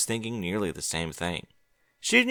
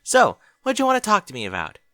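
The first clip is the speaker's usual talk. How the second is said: lightly raised, brighter Twilight voice; Twilight's voice; 10 semitones higher, 8 dB louder than usual. Acted. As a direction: voice Twilight, curious and friendly. Narrator voicing Twilight.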